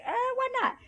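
high-pitched, offhand 'why not'; shrugging agreement. Retold or imitated. Acts out her shrugging reply.

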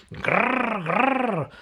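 high, loud mock chainsaw growl. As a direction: growl it like a roaring saw. Chainsaw noise in story.

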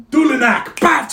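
high, explosive spell cry; shouted incantation. Acted. Wizard teleports the gathered party away, shouted incantation. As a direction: fire off the magic words loudly.

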